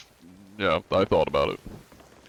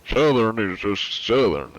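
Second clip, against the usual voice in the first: drawn-out Southern twang; Southern accent; slower than usual. Shown as a demonstration. Streamer defending their Southern accent, Southern drawl. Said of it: "Southern accent".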